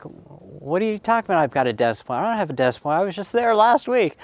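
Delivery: mock-baffled, pitch jumping up; retold protest. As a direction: replay a kid's incredulous objection, rising high at the end. Boy protests to his dad.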